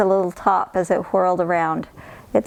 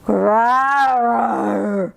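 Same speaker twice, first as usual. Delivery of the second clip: bear growl; 2 semitones higher than usual. Acted.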